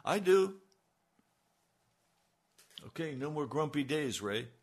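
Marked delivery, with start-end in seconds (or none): 0.1-0.6 exclaimed. Real, not acted. Preacher answering his own question.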